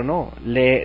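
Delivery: ordinary delivery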